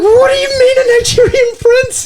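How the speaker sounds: very high, squealed mock disbelief; hammed-up high-pitched astonishment; comic incredulity. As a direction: shoot way up in pitch, drawing out the outraged question. Retells his escalating disbelief at her.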